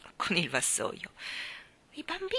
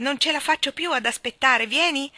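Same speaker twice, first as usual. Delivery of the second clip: loud, higher, can't wait; impatient shout; 8 semitones higher, 7 dB louder than usual. A narrator's reading. Michael shouts to Jane, impatient to leave. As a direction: yell it, bursting to go. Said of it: "le gridò impaziente".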